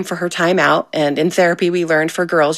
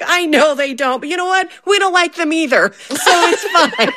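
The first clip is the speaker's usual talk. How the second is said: higher, giggling through the husband's joke; husband, laughing; 10 semitones higher than usual. Retold or imitated. Wife quoting husband to friends, laughing. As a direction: play the husband's deadpan joke but crack up. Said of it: "he's like".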